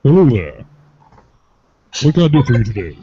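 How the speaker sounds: loud, booming friendly nurse; big hearty Sulu impression. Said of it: "my best Sulu voice".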